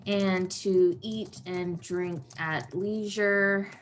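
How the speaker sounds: slow, drawn-out dictation; measured words at handwriting speed; writing aloud. Real, not acted. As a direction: say it slowly as you write it down. Dictating while writing the plan.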